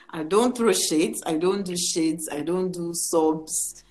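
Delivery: normal talking voice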